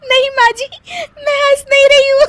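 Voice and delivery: squeaky-high denial breaking into laughter; laughing denial. Acted. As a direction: deny it while cracking up. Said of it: "फिर से हंस रही हो". (हंसी दबाते-दबाते ठहाका)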